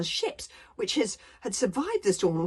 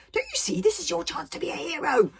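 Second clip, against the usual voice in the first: high, excitable friend's voice; eager urging; 9 semitones higher than usual. Acted. Fishlegs urges Hiccup to be a hero, high eagerness. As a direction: urge him on in a high, eager voice.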